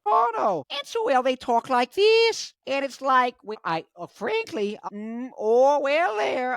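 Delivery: high-pitched, hammed-up Toronto accent; Canadian accent. Imitating relatives from Toronto, exaggerated Canadian accent. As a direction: go crazy with a caricatured Canadian accent. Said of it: "I'm getting crazy with the accent".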